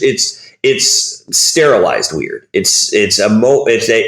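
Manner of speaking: normal talking voice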